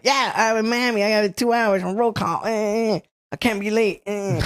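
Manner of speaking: high-pitched pleading with whimpers; comic high whimpery excuses; mock whining plea. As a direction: imitate a frantic friend whining to an officer. Friend whining to the trooper.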